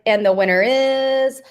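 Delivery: drawn-out mock award reveal; hammed-up winner announcement; excited announcer. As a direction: stretch the name into a slow climb, then drop. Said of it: "He's so excited".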